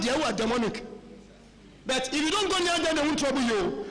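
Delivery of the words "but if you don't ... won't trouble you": ordinary delivery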